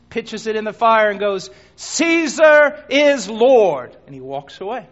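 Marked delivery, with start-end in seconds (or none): 1.8-4.0 loud, slow, drawn-out declaration; defiant proclamation. Acted. Second unbeliever at the altar, slow loud proclamation. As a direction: proclaim it slowly and loudly to the crowd.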